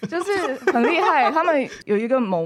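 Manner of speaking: ordinary delivery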